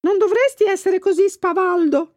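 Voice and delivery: high little fish voice, scolding; gilthead voice. A narrator's reading. Gilthead scolding the pike in a high voice. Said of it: "disse l'orata al luccio".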